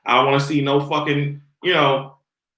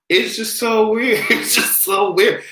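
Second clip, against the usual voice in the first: ordinary delivery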